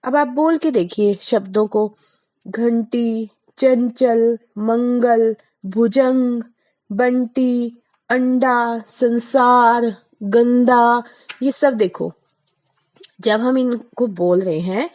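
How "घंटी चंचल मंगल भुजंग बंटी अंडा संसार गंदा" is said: घंटी चंचल मंगल with the nasal sound stressed clearly; nasal demo. Shown as a demonstration. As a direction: bring out the nasal in each word. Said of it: "इसका उच्चारण अनुस्वार का नाक से होता है". (अनुस्वार की नासिक ध्वनि उभारकर)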